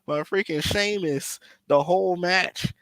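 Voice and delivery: high-pitched, worked-up calling out; exasperated yelling. Retold or imitated. Viewer urging wrestler to help his partner, exasperated yelling. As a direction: holler in disbelief at a useless teammate. Venting at a lazy tag partner.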